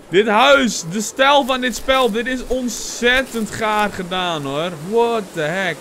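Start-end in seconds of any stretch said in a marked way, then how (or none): none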